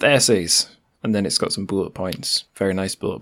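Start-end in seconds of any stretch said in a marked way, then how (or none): 0.0-0.7 bold text voice. Shown as a demonstration. Host redoing the bolded blurb words, bold text voice. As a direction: say essays big, as if bolded. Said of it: "essays is also bolded".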